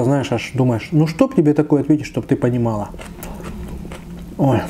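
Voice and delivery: usual speaking voice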